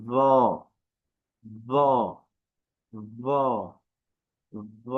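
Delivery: "ভ" as soft Arabic Zha with tongue tip at the teeth tips; gently buzzed, heavy "zo" for "ভ"; isolated "ভ", soft consonant without a long pull; soft, short sound. Shown as a demonstration. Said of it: "নরম আওয়াজ".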